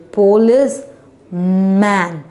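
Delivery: "police man" with a clear pause between parts; split into syllables. Shown as a demonstration. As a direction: say "police", stop, then "man". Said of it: "We just divided into two syllable".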